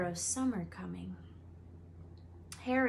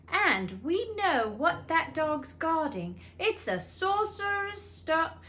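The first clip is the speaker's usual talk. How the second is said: excited boast; 8 semitones higher, 5 dB louder, slower than usual. A narrator's reading. Ron boasting, cut off.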